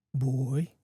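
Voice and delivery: low wolf voice, almost growling; deep, drawn-out creature voice. A narrator's reading. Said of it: "In a deep, almost growl".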